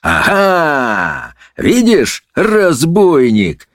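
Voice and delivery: high triumphant cry, last word drawn out; gloating high outcry; shouting judge. A narrator's reading. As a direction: crow the accusation at the culprit. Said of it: "закричал судья на волшебника".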